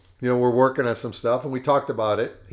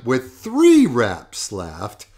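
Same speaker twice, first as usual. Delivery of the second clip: ordinary talk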